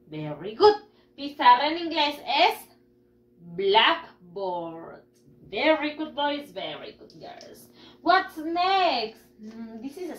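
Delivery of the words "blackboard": "Blackboard" in two drawn-out parts, A of board silent; practice model. Shown as a demonstration. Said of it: "tacho la A y no pronuncio la A". ("Blackboard" para practicar, sin la A de board)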